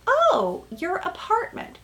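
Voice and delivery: high, mock-surprised oh; imitating a polite but let-down reply; high-pitched mock surprise, then trailing off; Rachel's flat reaction. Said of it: "Rachel's like".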